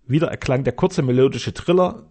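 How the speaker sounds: ordinary delivery, nothing marked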